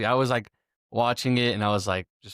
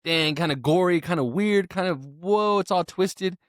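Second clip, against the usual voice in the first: acted-out wowed reaction, pitched up; lightly hammed-up astonishment; mock amazement; 7 semitones higher than usual. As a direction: play at being weirded out, higher voice. Acting out his reaction to shows.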